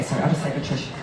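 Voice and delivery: ordinary delivery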